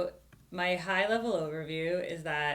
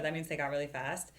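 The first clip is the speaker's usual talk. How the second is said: ordinary talk, nothing marked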